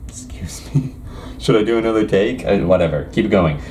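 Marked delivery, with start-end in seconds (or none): none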